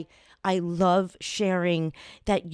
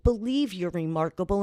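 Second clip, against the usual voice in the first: usual speaking voice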